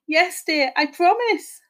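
high, meek whimper. A narrator's reading. Husband promises his ghostly wife, whimpering. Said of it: "Horace whimpered".

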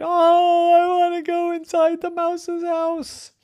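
high whining wail; whiny crying. Acted. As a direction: wail like a sulking child. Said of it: "they all cried".

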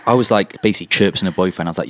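normal talking voice

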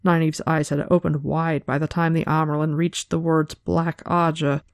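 ordinary talk, nothing marked

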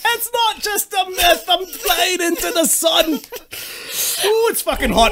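mock Scottish Icarus. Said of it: "doing a Billy Connolly".

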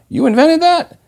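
mock incredulous yell. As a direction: yell out from the audience in mock disbelief. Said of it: "someone yells out".

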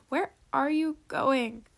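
slow, drawn-out questioning call. A narrator's reading. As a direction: call the question after someone walking off. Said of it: "a voice called".

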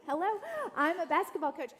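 re-introducing herself after a missed intro, hammed up; mock self-introduction. Shown as a demonstration. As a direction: announce it like a grand intro. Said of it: "You failed to mention that in my intro".

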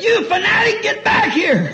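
high shrill shout at the duck; bossy hen screeching; scolding hen. Acted. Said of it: "The old hen said".

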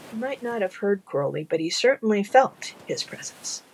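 usual speaking voice

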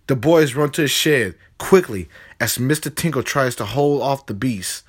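plain reading voice

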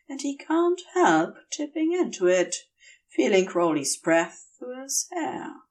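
plain reading voice

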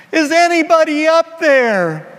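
high, loud panicked yell upward; panicked shout. Acted. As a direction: scream it as the root snaps. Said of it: "the root goes snap".

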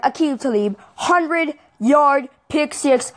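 fan announcing the game-winning pick six, excited. Real, not acted. Fan telling viewers about the winning interception, excited. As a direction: stretch it out, thrilled and drawn-out.

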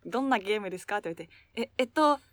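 ordinary talk, nothing marked

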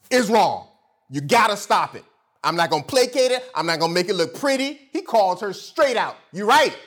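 loud, high-energy sermon delivery; punchy pulpit rebuke; full-force preaching. Real, not acted. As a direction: call out the wrong like a fired-up preacher.

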